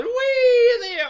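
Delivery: put-on storyteller voices Lucky for children, high and eager; eager little bear. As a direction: play the bouncy toy bear itching to go out. Lucky bouncing.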